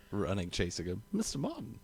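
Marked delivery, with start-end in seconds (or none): 1.1-1.8 calling out Mister Martin, much higher and soft; a high, breathless call after him; imitated movie call. Fan quoting a chaser calling after Martin, high and soft. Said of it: "I just love the way that guy says it".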